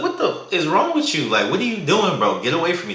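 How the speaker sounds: put-on high, outraged girl snapping at a stranger; mock offended girl telling a guy off; annoyed girl. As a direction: push him off, shocked and disgusted. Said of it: "She's like".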